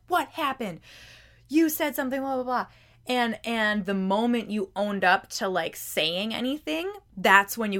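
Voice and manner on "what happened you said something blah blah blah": mock angry mom. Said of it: "your mom came storming into the room".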